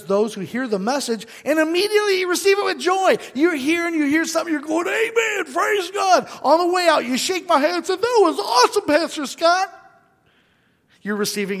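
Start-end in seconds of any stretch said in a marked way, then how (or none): 1.4-9.7 high, loud, gushing churchgoer praise; mock joyful churchgoer. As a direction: gush like a thrilled member shouting amen. Mimics excited joyful churchgoer.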